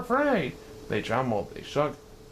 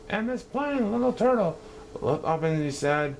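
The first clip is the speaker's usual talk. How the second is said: usual speaking voice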